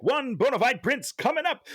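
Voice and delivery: high, excited mock Genie voice; imitating Genie. Retelling the Aladdin ending to Dan, high mock Genie. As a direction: toss it off like a cheerful genie. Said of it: "He's like".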